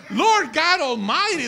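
loud, high mock revival cry; hammed-up desperate shout to heaven; imitated yelling. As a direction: holler it like a panicked passenger praying. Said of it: "everybody's yelling".

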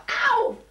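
loud, high-pitched exaggerated "ow"; mock cry of pain. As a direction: yelp as if your hand were being squashed. Teacher mimes pain when pressed.

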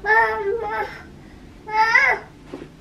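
high breathy giggle; stifled giggle as he sneaks off; muffled sneaky laugh. Real, not acted.

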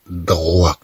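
droi stretched, consonants pulled apart; slow down on "droi"; drawn-out droi. Shown as a demonstration. Teacher draws out the syllable. (نطق droi ببطء)

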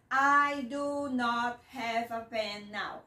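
slow, clear model for learners; careful teacher demonstration; slow model sentence. Shown as a demonstration. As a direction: spell it out slowly for the class. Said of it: "slow model sentence for learners".

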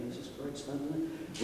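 plain reading voice

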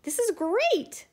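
high, excited exclamation; delighted cry. A narrator's reading. Snowman after eating ice cream, delighted cry. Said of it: "the snowman cried".

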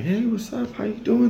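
high, cheery hello in a put-on voice; mock high greeting. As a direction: greet them back in a squeaky tone. His own reply retold.

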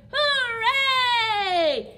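put-on high, loud hooray for the kids; cheering shout. Teacher leading kids in a cheer, loud happy shout. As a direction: cheer it out big. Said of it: "shout hooray".

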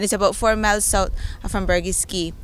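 usual speaking voice

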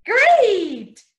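big cheerful teacher praise; excited praise. Acted. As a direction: cheer the kids on. Praising kids' answer.

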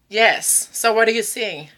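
ordinary talk, nothing marked

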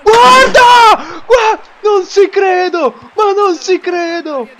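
high, loud amazed yells; excited shouting. Real, not acted. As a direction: scream with disbelief. Amazing goal in match.

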